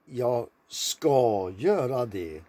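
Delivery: Jag ska göra det said slowly, göra drawn out with a falling tune; unhurried Swedish example; slow Swedish demo. Shown as a demonstration. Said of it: "they say".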